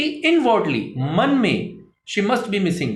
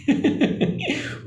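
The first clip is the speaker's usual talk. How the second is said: soft laugh; 6 semitones higher, 4 dB quieter than usual. Real, not acted. Laughs at own joke.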